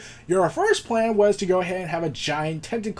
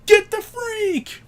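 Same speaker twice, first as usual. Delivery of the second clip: high, slow, jeering yell; crowd-like whoop, pitched way up and stretched out; cheering shout; 3 dB louder, slower than usual. Retold or imitated. Comedians just cheering.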